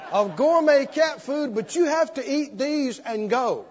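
ordinary talk, nothing marked

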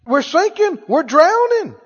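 high, pleading cries of Master; panicked disciples crying out. Retold or imitated. Disciples in the storm crying to Master, high and frantic. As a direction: voice the frightened disciples begging Master. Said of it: "And they said".

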